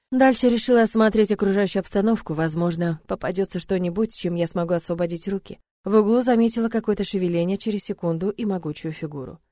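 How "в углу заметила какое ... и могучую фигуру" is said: plain reading voice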